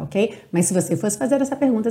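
usual speaking voice

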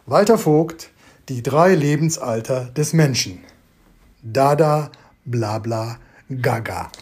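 measured recital, dropping on the last words; solemn reciter of a nonsense poem; formal poetry recital. Shown as a demonstration. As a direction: recite it deliberately, with weighty pauses. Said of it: "wie es sich für einen Rezitator gehört".

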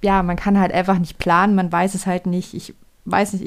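usual speaking voice

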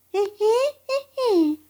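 high squeaky giggle; ball giggle. Acted.

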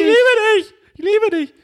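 put-on shrill screaming fan girl; screaming crying fan. Fan girl greeting sweaty player.

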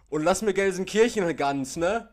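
high, loud mock farewell; mock Ruhrpott goodbye. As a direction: ham up a brash goodbye. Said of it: "haben sich gegenseitig verabschiedet mit".

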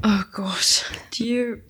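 dreading whisper. Acted. Dreading friend's next song pick.

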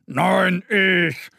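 bellowing boar. Acted. Boar bellowing at Mo over the path, mock shout. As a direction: bellow it back. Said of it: "brüllt das Wildschwein".

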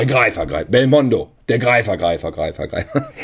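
low echoing trailer announcer. Retold or imitated. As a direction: boom the title, then let it echo away. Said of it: "Die Stimme mit dem Hall".

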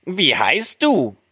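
high, slow dwarf question; friendly dwarfs. A narrator's reading. Dwarfs ask the waking girl, friendly high voice. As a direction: ask kindly in a high little voice. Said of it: "waren aber freundlich und fragten".

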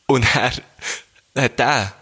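usual speaking voice

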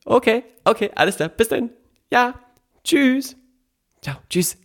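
high, sing-song phone goodbyes; mom on the phone. Retold or imitated. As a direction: play mom hanging up the phone. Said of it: "meine Mutter imitiert".

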